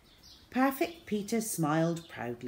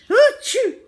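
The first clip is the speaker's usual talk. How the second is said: very loud, high acted sneeze; fake sneeze; 15 dB louder than usual. Boy dodging his injection with a loud fake sneeze. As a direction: explode into a put-on sneeze. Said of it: "Henry sneezed".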